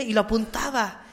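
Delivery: usual speaking voice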